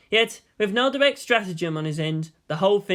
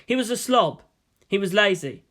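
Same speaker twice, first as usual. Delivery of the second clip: ordinary delivery, nothing marked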